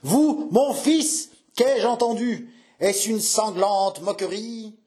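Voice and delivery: high, drawn-out, scandalised questions; incredulous old man. Acted. Old father suspecting the suitor mocks him, incredulous outcry. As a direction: reel at a cruel mockery.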